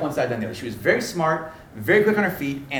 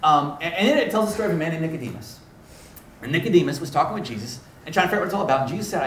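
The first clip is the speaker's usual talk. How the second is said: usual speaking voice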